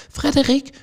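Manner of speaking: high squeaky call. A narrator's reading. The hungry mice call to Frederik, high and loud. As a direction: call him like eager little mice. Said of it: "riefen sie".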